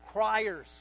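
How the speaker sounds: normal talking voice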